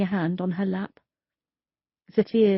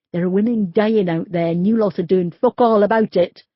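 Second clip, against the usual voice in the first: louder and louder, furious at the police; angry outburst; 7 dB louder than usual. Acted. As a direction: build it into a furious shout. Grieving man rants at police.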